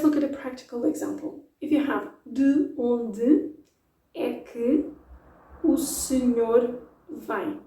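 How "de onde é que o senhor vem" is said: very slow, each word separate. Shown as a demonstration. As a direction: enunciate every word slowly. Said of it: "Saying it very slowly, enunciating every word".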